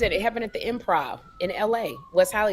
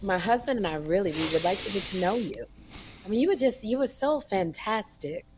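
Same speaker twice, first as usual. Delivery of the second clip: sultry voice; 2 semitones lower, 6 dB quieter than usual. Retold or imitated. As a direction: purr it like a flirt. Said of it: "in that sultry voice".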